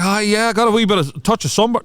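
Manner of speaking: high, put-on casual brag; imitating sunburned friend. Friend telling the girls about his sunburn, high mock voice. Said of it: "John was like".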